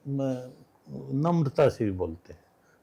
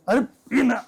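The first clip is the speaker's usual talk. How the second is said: blunt rustic call; 10 semitones higher, slower than usual. Retold or imitated. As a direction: bark it like a Haryanvi elder ordering. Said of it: "हम तो ऐसे ही कहेंगे".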